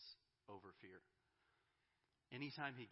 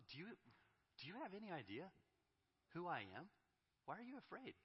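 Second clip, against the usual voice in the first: much higher, incredulous challenge; God confronting fear, high and incredulous; confrontational; 8 semitones higher, faster than usual. Retold or imitated. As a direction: demand who they think you are. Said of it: "that tone of confrontation".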